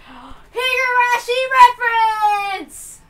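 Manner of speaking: excited gasp. Real, not acted. Gamer spotting a Higurashi reference, excited gasping cry. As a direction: blurt it out thrilled at the reference.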